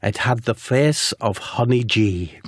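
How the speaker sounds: slow, deliberate reveal; dramatic reveal. Acted. Narrator revealing the dastardly beast's face, slowly and deliberately. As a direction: let the name land slowly.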